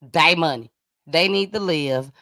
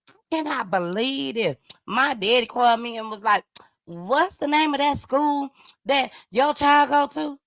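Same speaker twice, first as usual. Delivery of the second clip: higher-pitched acted venting; worked-up friend; 7 semitones higher than usual. Friend telling of her daddy's call, acted worked-up. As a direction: relay the story in a high, hammed-up phone voice. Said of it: "I am so mad right now".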